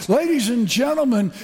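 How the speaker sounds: normal talking voice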